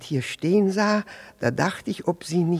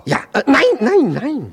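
high, loud, tumbling nos; flustered denial; 11 semitones higher, 10 dB louder than usual. Acted. Jealous man denying it is his wife, high loud flustered protest. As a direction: blurt the denial out in alarm.

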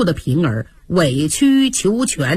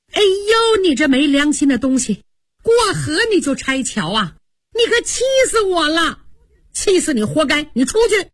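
high, fast furious scolding; angry outburst; 6 semitones higher than usual. Acted. Ping'er rounds on the ungrateful master, high furious scolding. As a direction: let her temper explode at him. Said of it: "平儿一听可就火了".

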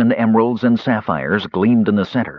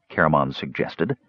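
usual speaking voice

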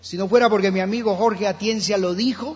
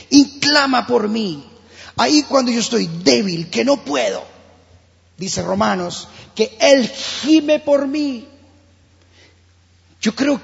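rising fervent preaching; 4 semitones higher, 4 dB louder than usual. Real, not acted. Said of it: "predicación a toda fuerza".

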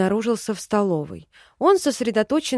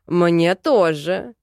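slow, louder man's voice; man catching up; 4 semitones lower, 5 dB louder, much slower than usual. A narrator's reading. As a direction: blurt it out belatedly. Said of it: "спохватился Тей". (запоздалый возглас мужчины)

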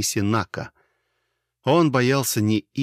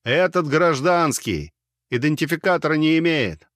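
loud, higher shout over rotors; 6 semitones higher, 3 dB louder than usual. A narrator's reading. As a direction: call it out over the engines. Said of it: "прокричал". (громкий крик)

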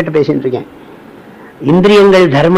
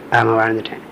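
softer, humble yes; meek soft reply; 5 semitones lower, 7 dB quieter than usual. Retold or imitated. Devotee answers the swami meekly and softly.

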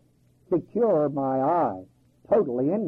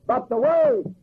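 the Word flung out high and loud; Word rung out high and strong; emphatic Word; 5 dB louder, slower than usual. Real, not acted. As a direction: hit the Word hard, pitch leaping up.

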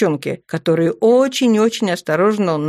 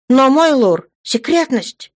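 high, louder objection; alarmed protest; 8 semitones higher, 4 dB louder than usual. A narrator's reading. Guard commander objects to the lord's order, protesting. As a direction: protest urgently, pitched up.

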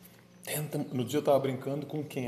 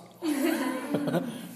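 high laugh; 12 semitones higher than usual. Real, not acted. Pastor laughs at Kátia's answer, high laugh.

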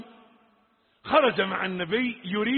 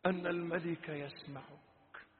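soft, paused reproach; quiet admonition; 3 semitones lower, 10 dB quieter than usual. Real, not acted. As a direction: speak low-key, ending in a soft, low prayer. Drops to quiet reproach and prayer. (موعظة هادئة تنتهي بدعاء خافت)

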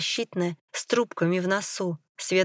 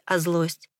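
plain reading voice

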